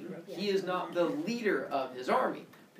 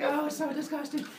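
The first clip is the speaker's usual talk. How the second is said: high, squealing revulsion; mock disgust; 12 semitones higher than usual. Kronos gets hit by tummy goo, high mock disgust. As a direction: recoil from it in falsetto.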